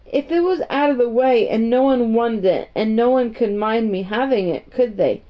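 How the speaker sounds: ordinary delivery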